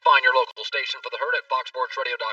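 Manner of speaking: loud radio promo read. Acted. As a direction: sell it like a station promo. Host reads podcast promo.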